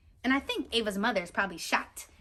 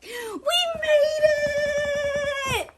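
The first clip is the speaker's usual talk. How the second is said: much higher, loud triumphant shout; excited exclaim; 5 dB louder, slower than usual. Acted. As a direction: shout it out with joy on arrival. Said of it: "Ava exclaimed".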